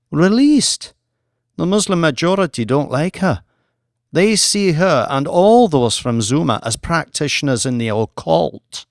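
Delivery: a hint of the official Jean, pitch jumping up on Released; lightly voiced diplomat, taken aback; incredulous official. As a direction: scoff at the request, rising.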